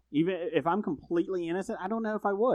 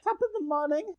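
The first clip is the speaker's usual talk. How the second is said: bad Irish accent. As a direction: repeat the fake Irish greeting, pitched high. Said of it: "That's Irish".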